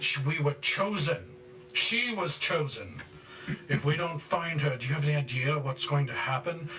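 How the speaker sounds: high, loud raging tirade; yelling father. Acted. Father berates his followers for not finding her, yelling. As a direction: yell in outrage at followers who failed you.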